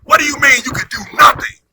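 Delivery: a boss who can't believe what he just heard; outraged boss. Retold or imitated. Trump stands up in outrage.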